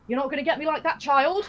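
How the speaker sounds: hammed-up gloating yell; mock shout. Mark Darrow taunting the narrator during the race, hammed-up loud gloat. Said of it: "he was like".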